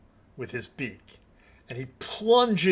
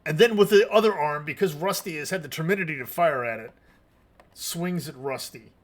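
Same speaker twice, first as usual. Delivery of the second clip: ordinary talk, nothing marked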